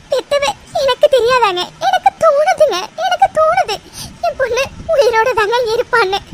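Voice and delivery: tearful, wounded insistence; crying. Acted. Mother begging her husband to stop saying their daughter died, crying. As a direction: protest and beg through tears. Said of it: "ஏன் இப்படி அழுற?".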